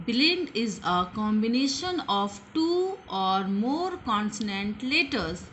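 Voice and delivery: slow, word-by-word English definition; slow dictation. Shown as a demonstration. Teacher dictating the blend definition to children, very slowly. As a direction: dictate each word so children can write it. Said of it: "teacher dictates definition slowly".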